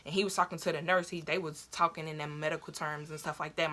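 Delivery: ordinary delivery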